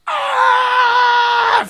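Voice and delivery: long, very loud, high scream; high-pitched scream. Retold or imitated. Friend flipping out at the other end, high scream. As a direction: let out a sustained shriek at full volume.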